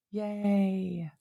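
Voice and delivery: sarcastic, drawn-out mock cheer; a half-hearted cheer for bad news; unenthusiastic yay.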